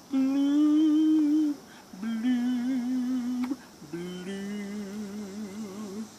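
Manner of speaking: mope it out, then let the blooms sink and fade; glum pout pout fish. Acted. Fish answers jellyfish glumly.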